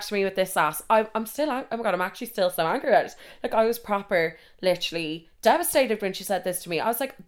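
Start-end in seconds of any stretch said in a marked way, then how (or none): none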